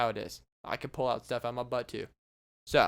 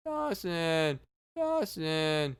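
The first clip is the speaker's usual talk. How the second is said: slow, drawn-out mummy groan-speech; talking mummy voice; much slower than usual. Pretend. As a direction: plead slowly as a droning mummy. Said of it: "dude, you sound weird".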